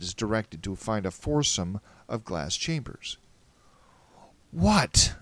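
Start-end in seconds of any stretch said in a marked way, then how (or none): 4.5-5.2 high, loud burst after holding breath; gasped question. Acted. Asking Julie once breath runs out, gasping. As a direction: gasp it out as the breath gives way. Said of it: "I gasped when I could".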